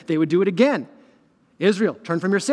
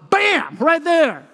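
mock impact; 7 semitones higher, much slower than usual. Retelling stubbing toe.

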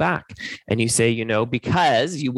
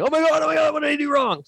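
high, frantic mock panic; panicked dentist; faster than usual. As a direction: squeal it like a dentist who just hit the pulp. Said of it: "This is you going".

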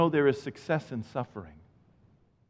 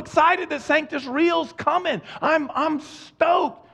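mock stoked; 11 semitones higher, slower than usual. Mock excited churchgoer.

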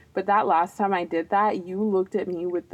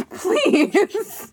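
high, louder please through laughter; real laughing embarrassment; 13 semitones higher, 4 dB louder than usual. Real, not acted. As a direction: beg through a burst of giggles. Embarrassed plea amid laughter.

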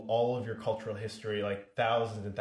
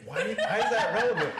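much higher and louder, breaking into laughter; laughing disbelief; 10 semitones higher, 6 dB louder than usual. Retold or imitated. Comedian quizzing his dad about the waiter, laughing high disbelief. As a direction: ask it through laughter, half appalled. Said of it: "He's like".

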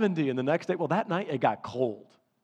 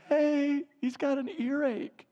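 put-on high, urgent kid voice; worried kid; 10 semitones higher, slower than usual. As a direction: raise it like a boy reporting trouble. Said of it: "Noah's like".

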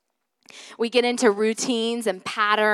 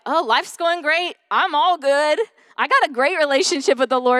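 high, breezy overconfidence; mock self-satisfaction; 6 semitones higher, 4 dB louder than usual. Believer telling herself all is good, mock breezy. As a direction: toss it out high and loud, all fine. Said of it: "sometimes I think we think".

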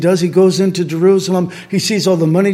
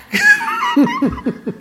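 a high burst of real laughter. Real, not acted. Laugh after joke.

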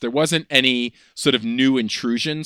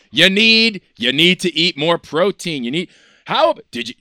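mocking know-it-all; 7 semitones higher, 5 dB louder than usual. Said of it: "people go".

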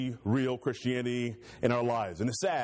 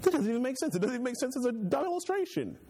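much higher, disbelieving; exasperated friend; 10 semitones higher than usual. Retold or imitated. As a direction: throw up your hands in disbelief. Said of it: "And you say".

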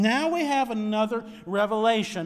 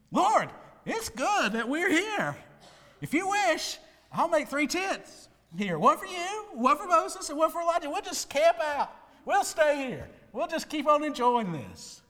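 higher, eager disciple babbling on; excited Peter; 8 semitones higher, 3 dB louder than usual. Acted. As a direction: Peter gushing over the mountain, higher and eager. Preacher voices Peter's eager proposal.

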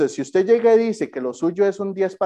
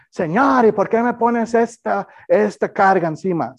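mock whiny complaint; 5 semitones higher than usual.

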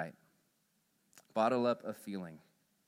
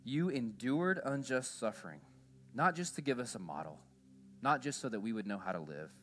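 prayer. Acted. Pastor leads closing prayer.

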